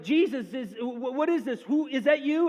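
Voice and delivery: mock alarm of men in a storm; freaked-out disciples. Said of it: "they freak out".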